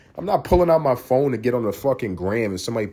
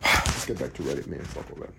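whispered dismissal; 10 semitones lower, 9 dB quieter than usual. Real, not acted. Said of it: "muttered dismissal".

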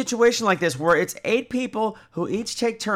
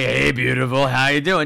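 flirty guy; 6 semitones lower, 4 dB louder than usual. Retold or imitated. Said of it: "this guy in the car is like".

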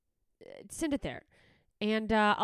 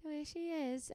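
higher, airy voice brushing it off; excusing dog owner; 8 semitones higher, 6 dB quieter than usual. Retold or imitated. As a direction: play the doting owner making excuses. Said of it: "And I just go".

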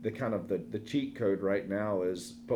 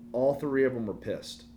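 usual speaking voice

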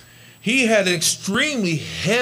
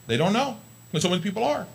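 ordinary talk, nothing marked

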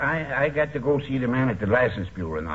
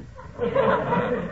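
a laugh that jumps far higher; squealing laugh at the billiard-cue story. Acted. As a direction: burst out laughing in a high squeal. Ole laughs at McGee's defeat.

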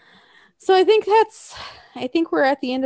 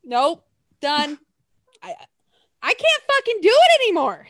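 exasperated outburst; 5 semitones higher, 5 dB louder than usual. Retold or imitated. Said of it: "I'm like".

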